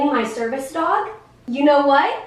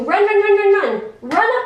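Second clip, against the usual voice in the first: mock excited run; 6 semitones higher, 3 dB louder than usual. Shown as a demonstration. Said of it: "do you think it would be a good thing".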